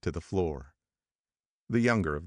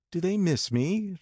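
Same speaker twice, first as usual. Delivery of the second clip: ecstatic cry; 11 semitones higher than usual. A narrator's reading. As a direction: cry it out in delight. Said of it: "she cried ecstatically".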